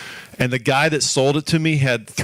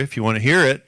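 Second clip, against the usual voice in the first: usual speaking voice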